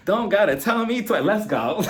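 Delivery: high, giggly mock eagerness; playfully game, laugh in the voice. Joking along with innuendo, laughing.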